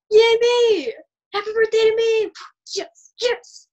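high, loud self-cheering; excited. Acted. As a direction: cheer for yourself wildly. Overexcited self-party.

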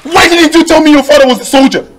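high, loud anguished ranting; hysterical lament. Acted. Forced groom raging that her father was a soldier, hysterical wailing.

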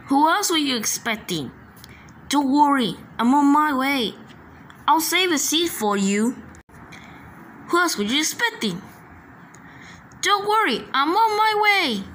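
high-pitched, eager character voice; excited high-voiced toy-train hero; eager call. Excited catchphrases in character, repeated.

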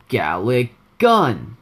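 slow, half-yelled attack name; Rice. Shown as a demonstration. Gamer's first try at his character's attack, slow held-back yell. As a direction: draw out the move name with only a partial yell. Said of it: "I was supposed to, like, yell a little bit".

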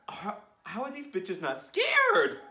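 high, loud, slow disbelief; astonished. Real, not acted. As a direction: marvel out loud. Amazed at the panther.

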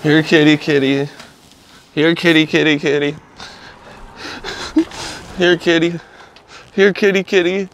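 calling a lost pet. Acted. Calling for the neighborhood cats.

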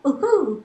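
high hooting ooh-hoo; spooky ooh-hoo. Acted. Gamer hooting at the creepy shaman, mock spooky. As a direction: hoot it up high like a ghost.

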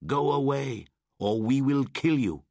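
high, loud crowd jeer; shouted. A narrator's reading. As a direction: drive the wolf child away. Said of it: "they shouted".

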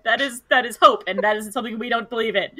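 ordinary talk

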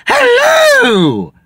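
very high shouted falsetto greeting; Elegant Eddie. Acted. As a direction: swoop down from a piercing, hammed-up hello. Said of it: "I am Elegant Eddie".

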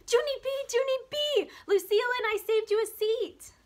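put-on high, loud, eager girl's call; bursting with glee to see her friend; excited friend calling. A narrator's reading. Grace calling Junie B over to the bus seat, high excited call.

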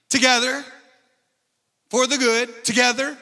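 loud, high revival preaching; building church preacher cadence. Acted. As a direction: preach it out to the whole room. Preaching at full force.

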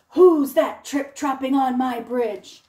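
loud, slow troll challenge; gruff troll. Acted. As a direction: bellow it like a troll guarding his bridge. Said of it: "he said".

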